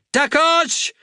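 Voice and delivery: high, loud urgent call for the medic; shouted call. Acted. Helska calls the medic as Xander falls, shouting. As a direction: yell across the road. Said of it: "Helska shouted".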